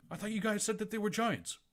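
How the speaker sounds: high, loud put-on questioning voice; mock confused character. GM retelling a scene from Gallant in a high, put-on voice. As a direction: play the baffled character. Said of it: "It's like".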